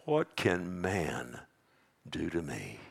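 slow, low, soft rhetorical question; hushed challenge. Acted. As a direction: sink down slowly and quietly. Bold declaration drops to hush.

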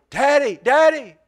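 high, loud imitation of a little kid calling; lost child's cry. Father imitating his lost little son calling for him, high shout. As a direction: call out for Dad as a lost boy. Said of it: "He was going".

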